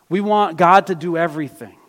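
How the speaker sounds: ordinary talk, nothing marked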